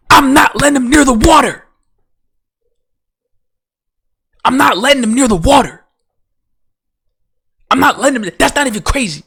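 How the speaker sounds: loud, high, indignant yelling; emphatic shouted refusal. Real, not acted. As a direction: holler it like it should be obvious. Ranting, repeats refusal emphatically.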